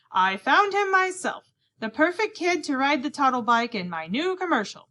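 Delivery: proud announcement. A narrator's reading. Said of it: "He announced".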